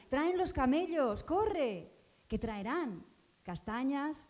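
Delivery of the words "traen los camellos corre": a louder, higher, slower shout. Retold or imitated. People urging the girl toward the camels, shouting higher and louder. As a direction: yell it across to the running girl. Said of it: "Me gritan".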